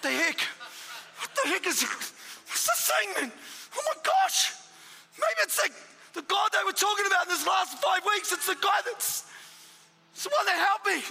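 a stunned man working out who spoke; astonished realisation. Retold or imitated. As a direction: gasp it out as it dawns on you. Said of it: "I was like".

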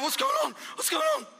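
a startled cry of "What's going on?"; panicked shout. Retold or imitated. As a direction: yell it in alarm, twice. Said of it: "I was like".

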